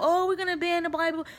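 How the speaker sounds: high, put-on mocking voice of the news. Mocking the news about banning the Bible, high and put-on. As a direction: sneer it like a news anchor announcing a ban. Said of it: "They put on the Bible".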